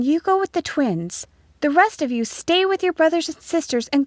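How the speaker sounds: high-pitched schoolteacher voice; high, firm teacher addressing the class; teacher giving instructions. A narrator's reading. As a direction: marshal the pupils home in a high, clear voice. Teacher gives pupils orders.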